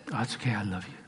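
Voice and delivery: soft, placating husband; mock sweet-talk. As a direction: soothe the wife with hammed-up tenderness, softly. Husband placates wife in skit.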